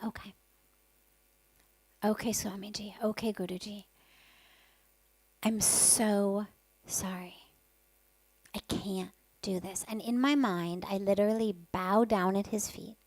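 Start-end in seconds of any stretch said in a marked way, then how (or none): 0.0-9.8 soft whispered pleading to the guru; hushed surrender. Retold or imitated. Disciple silently surrendering to her guru, soft whisper.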